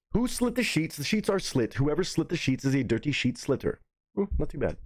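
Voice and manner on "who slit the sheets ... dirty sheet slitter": "Who slit the sheets?" said at normal speed; quicker run-through. Shown as a demonstration. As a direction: rattle it off at talking speed. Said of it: "let me do 'em the regular way".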